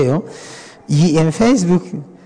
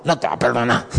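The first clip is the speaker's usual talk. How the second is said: mock harsh reply; 6 semitones lower, 4 dB quieter, faster than usual. Mocking harsh answer to sinner.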